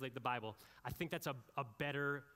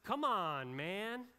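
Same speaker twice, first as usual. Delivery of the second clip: "Come on, man" as a TV sports analyst groaning at a bonehead play; sportscaster scolding a dumb play, "Come on, man"; exasperated "come on, man"; 5 semitones higher, 5 dB louder, much slower than usual. Shown as a demonstration. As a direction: toss "Come on, man" out in disbelief, "man" jumping up. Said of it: "how the E S P N guys say it".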